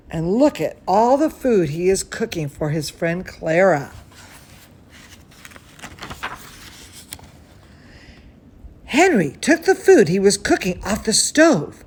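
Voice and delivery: put-on bright, lively read-aloud for kids; storybook narration to children; story reading. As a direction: read it like bedtime story time. Reading a picture book aloud.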